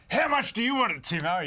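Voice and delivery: very high, loud imitated yell; mock coach's scream. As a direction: scream it like a fired-up coach at his player. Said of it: "and then screams at Timo".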